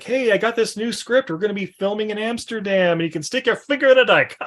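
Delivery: high, upbeat sales pitch; chirpy director pitch. Retold or imitated. Eli catching up with Lucky about a new script, upbeat. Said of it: "he's like".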